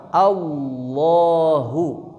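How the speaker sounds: slow "Allahu" with a thick lam, lips unrounded; "Allahu" said slowly, full lam, flat lips; correct heavy lam. Shown as a demonstration. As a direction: drawl "Allahu" heavy, lips not pouted. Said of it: "bibirnya tidak monyong".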